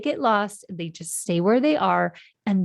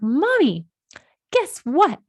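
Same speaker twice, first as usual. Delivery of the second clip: high, louder imitation of an eager child; excited little boy; 8 semitones higher, 6 dB louder, slower than usual. Son bursting to tell his mother news, imitated excitement. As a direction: pipe up like a kid with big news.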